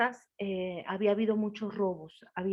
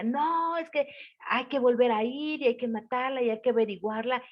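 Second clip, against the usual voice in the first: high, loud demand; insistent husband; 4 semitones higher, 3 dB louder than usual. Acted. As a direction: push hard to go back and kill it. Husband insists on hunting the witch. (esposo exaltado, exigiendo en voz alta)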